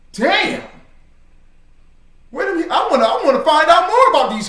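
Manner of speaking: high, loud, excited retelling; impressed outsider impression. Preacher imitates the rapper curious about Hebrew Israelites, excited.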